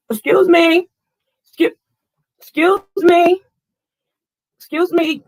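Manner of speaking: high, loud calls of excuse me; calling out. Acted. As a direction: call out to get attention. Calling to a driver.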